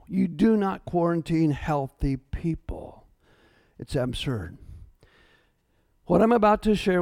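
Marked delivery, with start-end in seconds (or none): 0.1-4.6 slow, hushed whisper of conviction. Real, not acted. Pastor whispers his point to the congregation, slow hush. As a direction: lean in and whisper it slowly.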